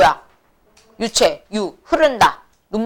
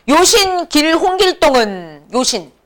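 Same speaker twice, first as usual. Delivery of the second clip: slow, higher, drawn-out archaic recitation; classical reading; 4 semitones higher, slower than usual. Acted. 길동이 붙인 방을 학생들에게 읽어주며, 느리고 높게 읊는 옛 낭독. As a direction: intone it like an old proclamation. Reading Gildong's poster aloud.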